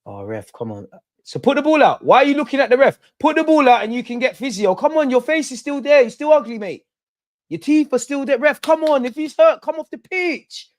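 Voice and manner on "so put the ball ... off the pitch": high, loud mocking tirade; angry rant. Real, not acted. Fan mocking an injured opponent, high and loud. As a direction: tear into the faking player.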